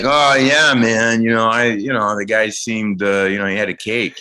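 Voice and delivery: imitating a lazy stoner usher. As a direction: play the unbothered stoner. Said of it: "stoner guy".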